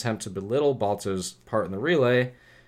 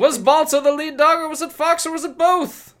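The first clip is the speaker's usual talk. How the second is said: put-on old radio announcer; 6 dB louder than usual. Dramatic radio teaser question.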